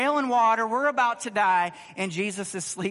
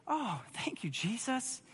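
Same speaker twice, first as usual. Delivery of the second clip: mock grateful disciples; 3 semitones higher, 9 dB quieter, slower than usual. Mock thanks the disciples never said.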